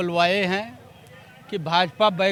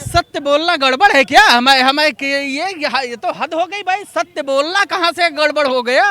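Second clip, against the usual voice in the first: loud, raised, exasperated objection; indignant protest; 6 semitones higher, 10 dB louder than usual. Real, not acted. Panelist defends his claim to the challenging anchor, loudly indignant. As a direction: push back loudly, pitched up.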